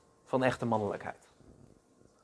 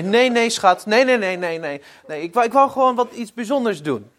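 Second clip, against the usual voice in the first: higher, louder, rushed no no no; flustered denial; 6 semitones higher, 4 dB louder than usual. Retold or imitated. Wife hastily reassures her startled husband, flustered and high. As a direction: hurry to reassure him, flustered.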